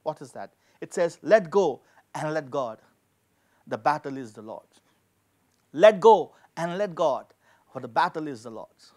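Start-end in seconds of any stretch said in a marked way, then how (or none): none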